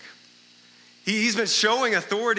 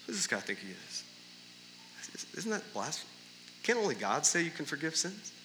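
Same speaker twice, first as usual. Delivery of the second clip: much softer, lower muttered thought; inner thought voice; 3 semitones lower, 12 dB quieter than usual. Pretend. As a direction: keep it inside, barely voiced.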